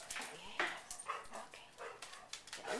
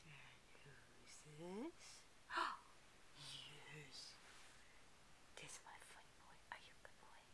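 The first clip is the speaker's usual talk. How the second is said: whispering; 7 semitones lower, 10 dB quieter than usual. Real, not acted. Whispering to sleepy dog.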